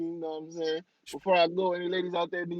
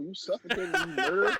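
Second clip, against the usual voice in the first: high laughing accusation; play-accuse him while cracking up; giggling mock outrage; 6 semitones higher than usual. Mock-accusing friend of bee murder.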